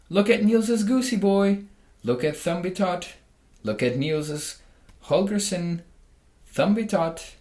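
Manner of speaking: high, loud taunting bird voice; sing-song bird taunt; mocking sparrow. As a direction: jeer at the boy like a cheeky sparrow. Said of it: "he called out".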